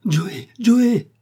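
high, loud panicked call; terrified cry. A narrator's reading. Doctor calling after Joey who has gone overboard, terrified cry. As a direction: call out in alarm, voice leaping up. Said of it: "fit le docteur terrifié".

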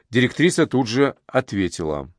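usual speaking voice

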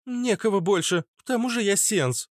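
put-on high girlish voice; reply plainly in a small high voice; matter-of-fact girl. Girl explains her posting to Ishtar, high-pitched.

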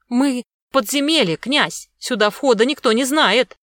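high, loud servile voice; eager toady's protest; placating underling. A narrator's reading. Underling placates angry prince.

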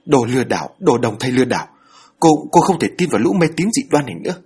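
woman shouting fraud at the medium; furious shout. Acted. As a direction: hurl the insult at her. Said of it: "hét vào mặt bà Đồng".

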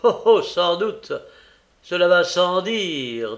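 loud, high jeering retort; mocking. A narrator's reading. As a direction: scoff loudly with drawn-out words. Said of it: "d'un ton railleur".

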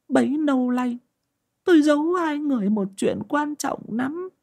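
high, tearful confession of a secret; a wife confessing through tears, voice high; high-pitched woman admitting she is a witch. Acted.

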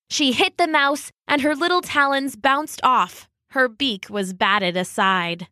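usual speaking voice

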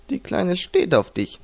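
high, slow teasing. A narrator's reading. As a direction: tease him, drawing it out. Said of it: "neckte ihn Chino". (gedehnt und hoch neckend)

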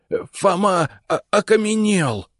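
high frightened stammer; stuttering. Acted. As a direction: stumble over the words in fear. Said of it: "заикаясь".

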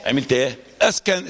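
ordinary talk, nothing marked

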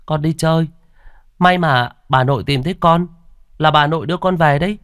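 put-on high-pitched guileless child; innocent boy. A narrator's reading. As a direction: answer parents artlessly as a little boy. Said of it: "Ông ngây thơ đáp".

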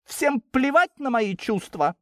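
indignant character voice, pitched high; indignant outburst. A narrator's reading.

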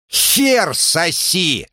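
high, slow screeching insult; shrieked insult. Acted. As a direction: shriek it as you swing. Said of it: "взвизгнул Кридмар". (визгливый выкрик)

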